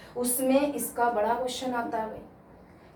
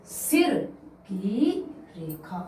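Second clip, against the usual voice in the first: सिर की रेखा in separate slow words, each stressed; syllable by syllable; 2 semitones lower, much slower than usual. Shown as a demonstration. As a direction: break it down for students. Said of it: "शिरोरेखा का मतलब क्या हुआ".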